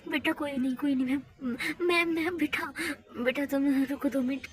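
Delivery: ordinary talk, nothing marked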